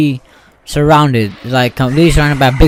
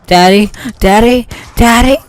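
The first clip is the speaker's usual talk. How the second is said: calling for daddy; 9 semitones higher than usual. Pretend. Child after the explosion calling for daddy, high and scared. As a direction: cry out for dad, rising in fear. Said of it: "And you're like".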